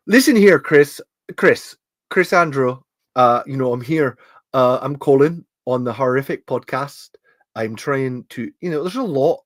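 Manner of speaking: put-on a light Scottish accent, chatty; lightly voiced Scot calling on a podcast. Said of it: "I think this is more Scottish".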